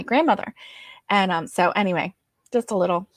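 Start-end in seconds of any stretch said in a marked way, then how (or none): none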